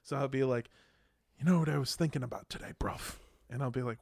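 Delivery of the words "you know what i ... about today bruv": softly imitated British mate; British accent. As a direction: put on a casual, quick British accent. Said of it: "he's got that British accent".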